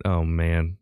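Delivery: normal talking voice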